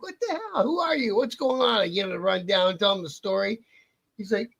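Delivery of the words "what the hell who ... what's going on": imitating surprise. Mechanic shocked by outlaw's arrival.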